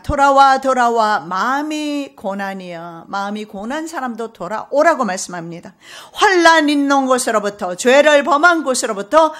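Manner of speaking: loud, driving sermon delivery; fervent loud preaching; full-force preaching. Acted. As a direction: preach it hard to the whole church. Declaiming hymn lyrics at full force.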